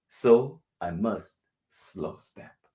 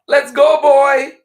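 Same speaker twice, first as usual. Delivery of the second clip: high, loud call to a dog; very high, excited shout; 14 dB louder than usual. A narrator's reading. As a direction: holler for your dog to come along. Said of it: "Little calls out".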